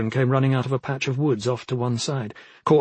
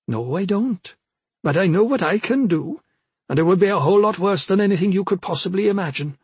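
counter-threat; 5 semitones higher than usual. A narrator's reading. Old woman answers threat with menace.

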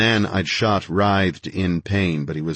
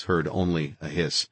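ordinary delivery

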